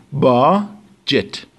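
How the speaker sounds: slow, careful BAJ-et, with no d before the j; budget said slowly. Shown as a demonstration. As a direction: spell out باجت slowly as "bajet", silent d. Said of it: "د خونده نمیشه".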